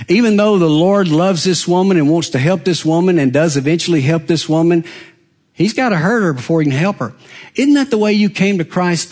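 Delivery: ordinary delivery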